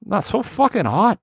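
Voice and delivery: high-pitched Boston complainer; Boston accent. Acted. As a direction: gripe in an exaggerated, squeaky-high Boston accent. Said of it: "that has that real accent".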